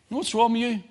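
hammed-up rising challenge; imitated pastor's challenge. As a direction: mimic the other pastor calling him out. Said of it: "he said".

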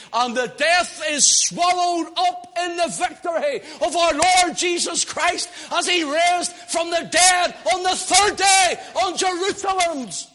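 high, loud preaching climax shouts; carried away. Real, not acted. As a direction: let the joy run away with you. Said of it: "I'm just getting carried away".

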